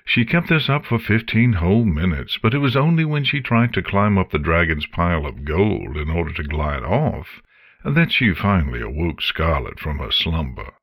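plain reading voice

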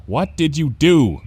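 wary, drawn-out question; asking. A narrator's reading. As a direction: ask it straight, with some concern. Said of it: "Bon Bon asked".

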